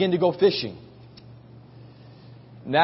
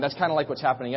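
usual speaking voice

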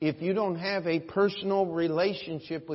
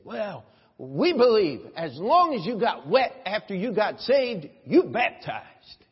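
put-on Deep South accent; 2 semitones higher, 5 dB louder than usual. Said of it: "somebody from way down south".

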